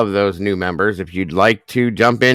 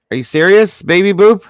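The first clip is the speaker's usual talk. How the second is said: high, loud, amazed; shocked disbelief; 11 semitones higher, 9 dB louder than usual. Real, not acted. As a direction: shout it in disbelief. Another big gift arrives.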